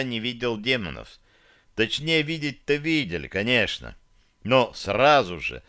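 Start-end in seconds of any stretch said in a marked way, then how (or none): none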